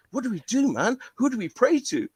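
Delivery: high, urgent panicked questions; a boy begging his friend for answers; mock panic.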